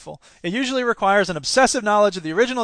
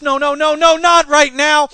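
loud, high, repeated protest; gasping panic; 8 semitones higher, 9 dB louder than usual. A narrator's reading. Woman with a failed gun facing the monster, panicked cry. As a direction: plead in mounting panic, drawing out the end. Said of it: "she gasped".

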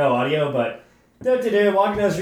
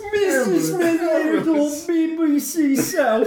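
put-on higher, laughing British hooligan accent; 7 semitones higher than usual. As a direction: read it in the put-on cockney accent. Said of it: "rate his accent".

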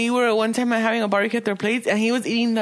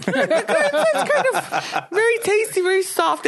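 put-on British accent; 10 semitones higher, slower than usual. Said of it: "where does that English accent come from".

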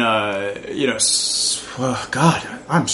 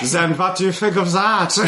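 higher, hammed-up German accent; 7 semitones higher than usual. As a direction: play a cartoon German villain. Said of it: "increasingly more German".

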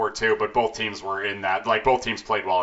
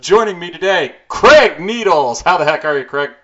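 awkward joy; 5 semitones higher, 8 dB louder than usual. Real, not acted. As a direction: hype up the guest name. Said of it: "I'm just so excited right now".

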